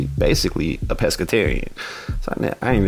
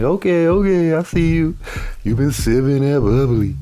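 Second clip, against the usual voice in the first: teasing; 6 semitones higher than usual. Acted. Teasing dad about champagne.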